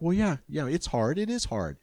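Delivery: normal talking voice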